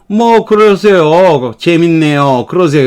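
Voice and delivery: slow, falling, resigned "뭐, 그러세요"; 체념한 듯이; amused resignation. Shown as a demonstration. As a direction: shrug it off, amused. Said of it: "즐거움 또는 체념".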